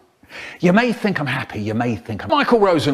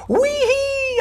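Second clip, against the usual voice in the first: very high, wild squeal of joy; gleeful whoop. Acted. Showing off frilly underwear, squealing whoop. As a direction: shriek it like a kid on a slide. Gleeful cry in silly story.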